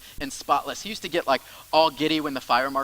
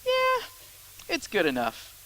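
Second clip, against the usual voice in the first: lazy inner voice; 12 semitones higher, slower than usual. Retold or imitated. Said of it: "in my mind I go".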